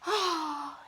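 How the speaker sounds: whispered sigh of relief; whispered relieved ah. Acted. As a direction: breathe out the relief. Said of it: "looks relieved".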